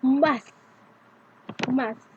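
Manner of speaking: "Math" said alone as the stressed syllable. Shown as a demonstration. Teacher showing the stressed syllable, "Math" stressed. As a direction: give "Math" full stress on its own. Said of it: "The first syllable is stressed".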